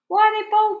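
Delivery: put-on high, loud startled child; boy's high voice. Shocked boy asks his grandmother, high and loud.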